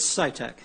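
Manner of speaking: Sitek said SY-tek, stress on first syllable; name pronunciation. Shown as a demonstration. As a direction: Sitek with long I, like sigh-tek. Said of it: "my mistakes in pronouncing your name".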